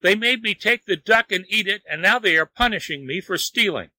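high-pitched wail of protest; yelled complaint. A narrator's reading. Wang blames the gods for his punishment, yelling. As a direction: protest high, like a wronged man. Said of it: "he yelled".

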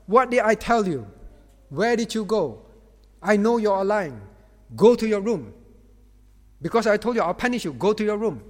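put-on furious parent yelling at his teen; retold angry shout. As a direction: bark orders at the son like an angry dad. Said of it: "and just shout".

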